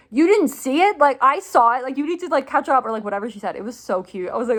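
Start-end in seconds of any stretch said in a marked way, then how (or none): 0.1-2.8 higher and louder teasing scold; playful mock yell. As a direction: tease him like a friend telling him off. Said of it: "like, yelling at An-, not yelling".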